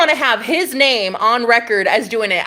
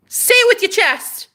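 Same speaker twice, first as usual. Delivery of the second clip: bold call; 12 semitones higher than usual. Shown as a demonstration. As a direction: say it as a bold challenge. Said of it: "repeated louder".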